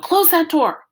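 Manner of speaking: high, loud family yell; yelled order. A narrator's reading. Mama, Dad and Joey to the boy running in, yelling. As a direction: yell it out sharply across the house. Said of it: "and Joey yelled".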